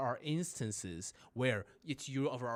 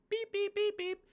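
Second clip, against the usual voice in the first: high-pitched mock beeps, beep beep; imitated beeping. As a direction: chirp like a bus card reader. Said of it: "start clicking a button".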